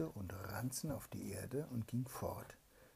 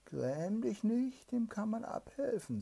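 sly spirit; 13 semitones higher, 7 dB louder than usual. Acted. Evil spirit appears before the hungry soldier, high sly greeting. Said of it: "sagte der Böse".

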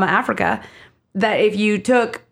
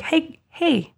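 sweet smile voice. Retold or imitated. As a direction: coax her like a gentle crooner. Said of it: "That is how [name]'s music feels to me".

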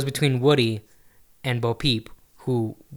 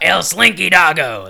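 slow, louder Spanish announcer with a laugh; Spanish announcer accent; 4 semitones higher, 8 dB louder, slower than usual. Retold or imitated. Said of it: "That's more accurate".

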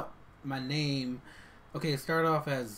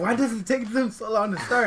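mock impatient audience; 9 semitones higher, 8 dB louder than usual. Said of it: "they're like".

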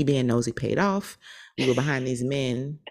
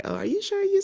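nervous high voice. Retold or imitated. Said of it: "Marcus was like".

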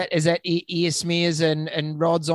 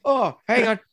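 lightly voiced owner, higher; higher pet owner voice; 7 semitones higher, 3 dB louder than usual. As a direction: play the owner a bit higher. Owner voiced in imagined scene.

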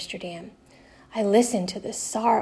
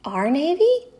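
higher and louder, startled; surprised question; 7 semitones higher, 6 dB louder than usual. A narrator's reading. Henrik questions his friend, surprised. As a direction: ask it as if you can't believe it.

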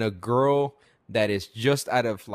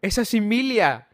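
put-on child pointing out a star; 9 semitones higher, 3 dB louder than usual. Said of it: "She goes".

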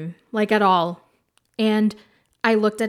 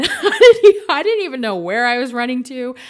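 laughing; 3 semitones higher, 8 dB louder than usual. Real, not acted. Laughing at her own escape.

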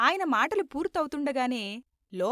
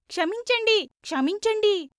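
high, insistent group chant; chorused plea; 7 semitones higher, 4 dB louder than usual. A narrator's reading. Seven disciples shaking Veerraju's hands, high chorused plea. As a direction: chant క్షమించండి high and forcefully, twice. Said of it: "ఏడుగురు ఏకకంఠంతో".

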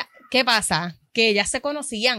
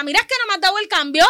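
mock indignant complaint; 7 semitones higher, 5 dB louder, faster than usual. Customer complaining about change.

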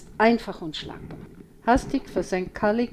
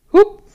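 a sudden high, loud hiccup; one sharp squeaky hiccup; startled hiccup; 12 semitones higher, 14 dB louder than usual. A narrator's reading. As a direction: hiccup from the fright. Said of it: "kriegt einen Schluck auf".

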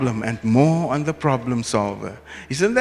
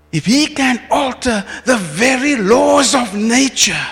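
full-force preaching; 7 semitones higher, 5 dB louder than usual. Real, not acted. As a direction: lift it up like a revival call.

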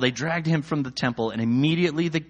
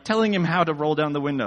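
ordinary delivery

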